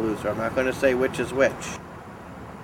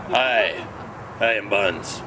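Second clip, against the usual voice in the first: a slightly lower, steadier Buns voice; 5 semitones lower, much slower than usual. Shown as a demonstration. Coaching Buns to sound less fruity, lower voice. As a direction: drop the voice down for Buns. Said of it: "you just need to lower your voice a little bit".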